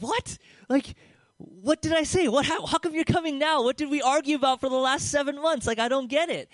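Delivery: high, loud, exasperated disbelief; baffled outburst. Retold or imitated. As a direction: throw up your hands in disbelief. Retells his frustrated baffled outburst.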